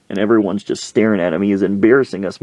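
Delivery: ordinary talk, nothing marked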